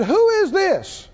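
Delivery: high, drawn-out thundering challenge; slow, commanding voice out of the whirlwind; God. Retold or imitated. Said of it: "And God spoke out of it and said".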